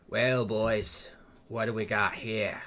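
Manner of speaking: rough bandit leader, lightly voiced; a hint of a cocky raider. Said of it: "One of them speaks as he approaches".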